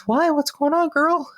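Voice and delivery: high-pitched, sympathetic cashier; checkout girl. Retold or imitated. Cashier asks a crabby customer what's wrong, high and concerned. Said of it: "the lovely girl checking me out".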